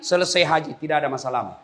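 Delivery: usual speaking voice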